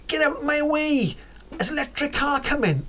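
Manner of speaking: high-pitched warning call from a car; talking car speaker. Pretend. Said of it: "I'd rather have the speaker go".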